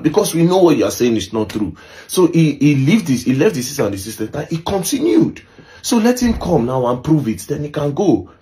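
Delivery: high, agitated retold protest; emotional retelling. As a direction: voice his upset insistence, pitched high. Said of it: "David was really emotional".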